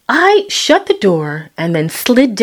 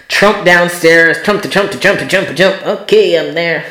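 ordinary talk

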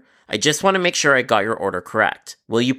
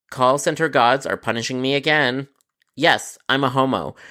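usual speaking voice

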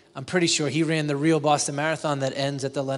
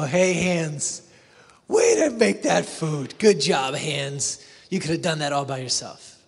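louder cheer at the cook's hands; mock praise to hands; 5 dB louder than usual. To the cook's hands, a mock cheer. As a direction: praise the hands, not the cook. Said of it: "look at her hands and go".

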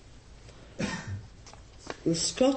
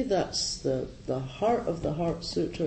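normal talking voice

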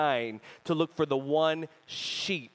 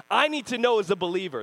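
ordinary talk, nothing marked

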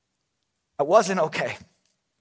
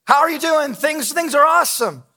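bright, higher mock small-talk; fake cheerful greeting; 7 semitones higher, 3 dB louder than usual. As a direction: chirp it like a hollow pleasantry. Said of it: "how we lie when we greet people".

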